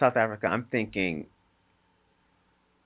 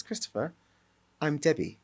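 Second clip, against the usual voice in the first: put-on South African accent; 4 semitones higher, 5 dB quieter than usual. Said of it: "With this, with this South African accent".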